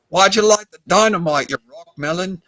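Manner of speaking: hammed-up Australian accent, scolding a mate; retold Australian accent, punchline. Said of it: "he breaks into a, an Australian accent".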